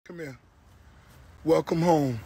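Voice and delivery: ordinary delivery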